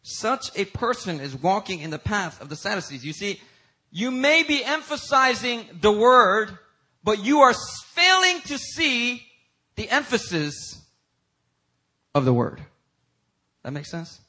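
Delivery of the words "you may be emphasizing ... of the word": high, loud, drawn-out preaching; emphatic preaching. Real, not acted. Preacher warning those who emphasize only the word, emphatic preaching. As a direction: press each phrase slowly and forcefully on the listeners.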